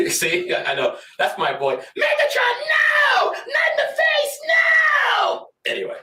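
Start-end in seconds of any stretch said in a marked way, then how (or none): 1.8-5.5 far higher, louder pleading shriek; Starscream begging. Pretend. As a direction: beg your leader not to hit you. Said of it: "Do Starscream".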